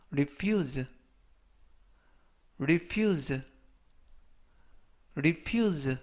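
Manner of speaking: "refuse" said clearly as ri-FYOOZ, stress on the second syllable; "refuse" spoken slowly and carefully with the stress at the end; pronunciation model. Shown as a demonstration. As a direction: model "refuse" for learners with a long, stressed "fuse". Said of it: "발음하시면 되겠고요".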